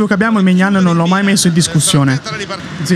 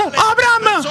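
frustrated shouting; 10 semitones higher, much slower than usual. Real, not acted. Missed chance during live match.

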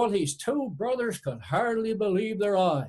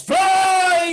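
long, high, full-voiced yell; top-of-voice shout; 10 semitones higher, 9 dB louder than usual. A narrator's reading. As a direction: bellow the magic word high and drawn out. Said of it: "he shouted the top of his voice".

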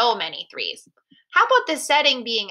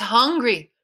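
ordinary delivery, nothing marked

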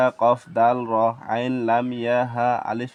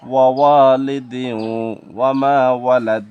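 projected, measured Arabic recitation; Quran recitation; 6 dB louder than usual. Shown as a demonstration. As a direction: recite out strongly, stretching the long vowels. Recites the assembled verse.

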